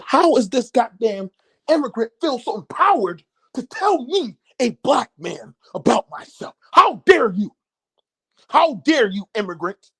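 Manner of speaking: mock-offended, climbing to how dare you; indignant outrage. To the immigrant neighbor who lectured him, high indignant outrage.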